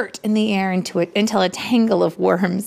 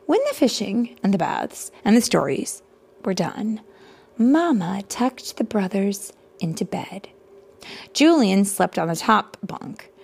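ordinary delivery, nothing marked

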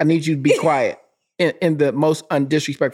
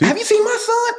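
much higher mom impression; proud mom voice. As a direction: squeak it like a proud mother. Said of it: "mother quoted".

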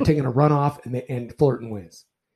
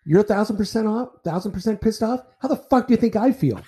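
retold angry comeback; 6 semitones higher than usual. Umpire to coach after a blown call, heated and incredulous. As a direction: throw his own words back at him, incredulous. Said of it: "And I said".

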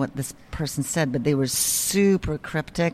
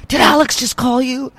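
caller voice, higher; 9 semitones higher, 7 dB louder than usual. Retold or imitated. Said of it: "And he said".

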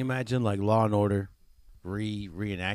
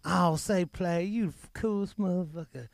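high mock praise; 8 semitones higher than usual. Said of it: "subway guy praising".

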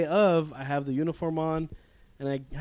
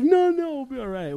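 high reassurance; 10 semitones higher, 4 dB louder than usual. Retold or imitated. Friend reassures.

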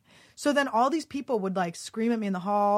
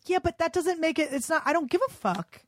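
pitched way up, indignant and swearing; raised angry retort; 6 semitones higher than usual. Retold or imitated. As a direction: throw it back at her, high and outraged.

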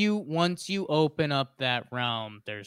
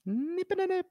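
high squeaky voice. Pretend. Repeating the phrase for fun.

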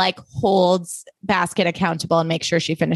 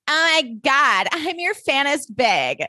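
put-on higher, slow drunken gush; drunk fangirl; 7 semitones higher than usual. Podcaster acting out drunk [name] gushing at [name], slow and higher. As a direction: gush like a tipsy fan. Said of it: "she's like".

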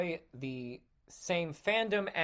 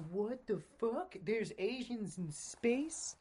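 mocking fanboy voice; 5 semitones higher, 6 dB quieter, slower than usual.

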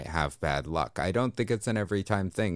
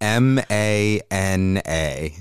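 slow spelled out; 6 dB louder, much slower than usual. Acted. Spelling magic as mana joke.

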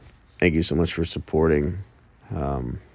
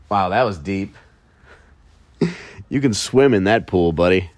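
louder, smug pat on the back; mock bragging; 4 dB louder than usual. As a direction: congratulate yourself, hammed-up. Self-praise after deep remark.

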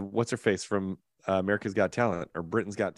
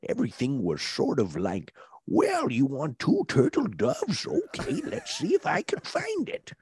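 shop owner impression; 5 semitones higher than usual. Shown as a demonstration. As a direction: talk like the film's toy seller, drawing words out and swooping high. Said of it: "He talked like this".

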